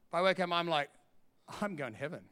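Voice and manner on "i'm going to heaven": very soft and low, wondering; hushed realisation. Retold or imitated. Preacher reliving waking up certain of heaven, hushed. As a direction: say it privately, as a dawning discovery.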